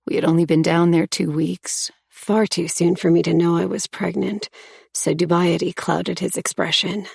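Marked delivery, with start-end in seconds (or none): none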